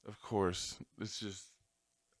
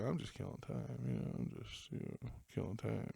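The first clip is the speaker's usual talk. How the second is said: mumbled bored quote; 10 dB quieter than usual. Retold or imitated. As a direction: imitate a bored guy muttering it away. Retelling the bored guy's words.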